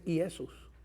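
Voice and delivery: Jesús dicho "iesús", con i inicial y sin jota; pronunciación griega. Shown as a demonstration. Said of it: "la pronunciación en griego es". (Jesús en pronunciación griega)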